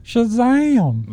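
high, stretched, falling swoop; drawn-out high magic-word cry; catchphrase call. Pretend. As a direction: sing it out as a playful sign-off. Sign-off catchphrase repeated.

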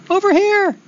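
tiny, very high squeaky call; small voice. Acted. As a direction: call out in a little creature's high voice. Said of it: "he hears a small voice".

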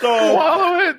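high laughing command. Real, not acted. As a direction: urge through laughter. Egging friend on, laughing.

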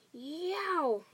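high, drawn-out "yow"; roared yowl. A narrator's reading. Giant roaring in a nightmare, high pained yowl. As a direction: howl it out as a roar of pain. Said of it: "roared the flesh lump eater".

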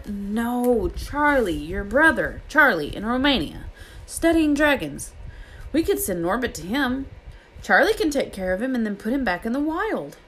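high, eager explaining; excited idea. A narrator's reading. Harry tells Ron his plan about Charlie, excited. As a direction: pitch it up with growing eagerness.

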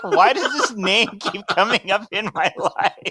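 exasperated. Retold or imitated. Retelling exasperation at recurring name.

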